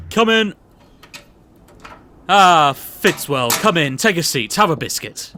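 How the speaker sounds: booming, jovial posh boss voice; pompous boss. Acted. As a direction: play the bluff, overbearing company boss. S0 plays the boss Mr Bathurst in the sketch.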